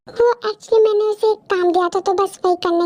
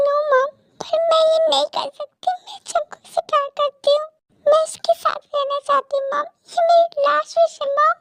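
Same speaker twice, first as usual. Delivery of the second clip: high, anguished daughter's appeal; desperate pleading; 6 semitones higher than usual. Acted. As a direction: beg mother with pitch held high. Daughter begs mother once more.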